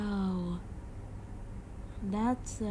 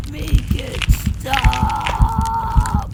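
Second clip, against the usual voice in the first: loud, slow, stretched-out wail on stop; drawn-out scream; 6 semitones higher, 14 dB louder, much slower than usual. Acted. Kid wails the plea out slowly in agony. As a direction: drag the scream out long. Begging during transformation.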